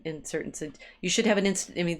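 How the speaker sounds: ordinary delivery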